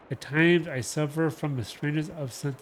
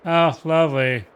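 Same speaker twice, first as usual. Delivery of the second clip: a louder, dry sarcastic "lovely"; mock delight at an unwanted ad; 3 semitones higher, 8 dB louder than usual. Real, not acted. Ad interrupts the reading.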